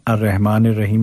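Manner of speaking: अर्रहमानिर as "ar-rehmane", with plain h; wrong everyday reading. Shown as a demonstration. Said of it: "नहीं पढ़ना है". (अर्रहमानिर को "अर्रेहमाने" पढ़ना)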